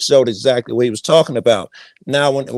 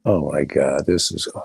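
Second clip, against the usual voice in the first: deep gas voice; 10 semitones lower, 5 dB quieter than usual. Pretend. Reacting to heavy gas demo.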